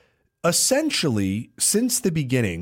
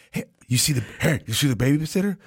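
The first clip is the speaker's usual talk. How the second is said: ordinary delivery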